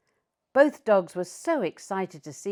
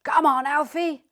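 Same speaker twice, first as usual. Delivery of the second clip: loud, higher cheering shout; 9 semitones higher, 8 dB louder than usual. A narrator's reading. Master cheering Alfie in the race, loud shout. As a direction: cheer from the sidelines. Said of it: "He could hear Master shouting".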